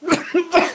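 sudden hard cough; coughing fit. Real, not acted. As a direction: cough as if something went down wrong. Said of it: "I am choking".

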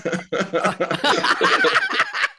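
a big, high, loud burst of laughter; hearty laugh. Real, not acted. Host cracking up at the guest's roof joke, loud high laugh.

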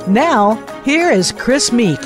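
normal talking voice

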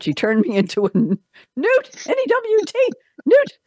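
hammed-up British accent, leaping high; very high-pitched, badly faked British peasant; bad Terry Jones. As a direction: attempt a silly, squawked British accent. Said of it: "I don't do a good Terry Jones accent".

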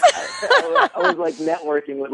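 laughing through the words; giggling as the story tumbles out. Real, not acted. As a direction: bursts of high laughter mid-sentence. Laughing while telling the story.

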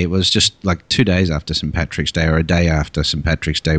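ordinary talk, nothing marked

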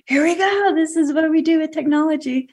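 high, bright mock announcement of the tech routine; a playful high put-on voice; mock excited voice. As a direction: squeal it like a giddy tech demo. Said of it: "And it's like".

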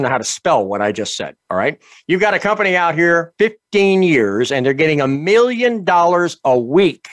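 pushy live radio ad; ad read. Acted. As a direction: sell it hard like a pitchman.

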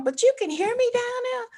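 high, rising disbelief; incredulous question. Retold or imitated. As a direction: ask it high, still stuck on the news. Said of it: "And I'm still on".

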